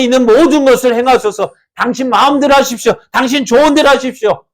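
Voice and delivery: eager prophet. Acted. Prophet urges king to proceed.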